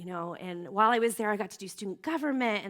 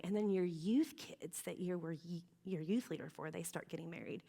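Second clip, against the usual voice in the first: ordinary delivery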